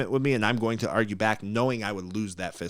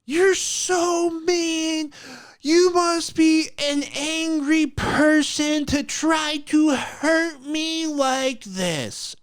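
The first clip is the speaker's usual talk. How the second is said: high, slow, louder mock-weeping woman; imitating her crying voice; 6 dB louder, much slower than usual. The recruiter sobbing that he is mean, high slow mock wail. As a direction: wail it like a hammed-up crier. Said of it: "this is what her voice actually sounds like".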